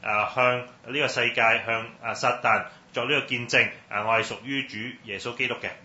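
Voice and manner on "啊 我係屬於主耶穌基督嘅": normal talking voice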